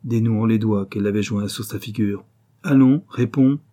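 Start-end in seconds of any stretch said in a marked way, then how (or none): 2.6-3.6 brusque, louder and higher; rough command. A narrator's reading. Father pressing his crying daughter to answer, rough. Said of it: "Il se fit plus rude encore".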